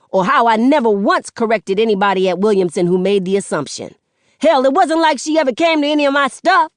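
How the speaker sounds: a son unloading on his mother, fast and loud; angry rant. Acted. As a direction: rattle it all off at her, fuming. Son's angry rant at stepmother.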